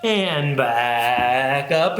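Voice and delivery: low drawn-out slide climbing upward; slow rising swoop; rising back up. Pretend. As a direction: start low and slowly slide up. Acts rising slowly from the ground.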